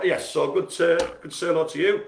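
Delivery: ordinary talk, nothing marked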